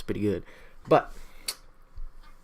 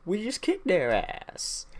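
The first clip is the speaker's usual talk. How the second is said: higher, slow cocky bragging; a slightly hammed-up victory boast; mock gloating cheer; 7 semitones higher, slower than usual. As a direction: brag like a smug winner. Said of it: "people are like".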